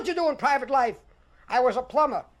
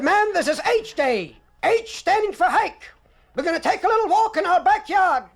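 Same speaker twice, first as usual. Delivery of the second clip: announcing sergeant; 5 semitones higher than usual. Retold or imitated.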